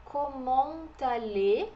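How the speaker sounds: Comment allez very slowly, T linked to A; slow liaison demo. Shown as a demonstration. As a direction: draw out Comment allez as comon-tale. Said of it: "a liaison do T com A". (Comment allez bem devagar, ligando T com A)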